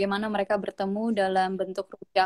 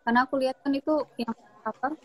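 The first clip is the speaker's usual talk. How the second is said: bad connection. Real, not acted. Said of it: "Suaramu putus-putus".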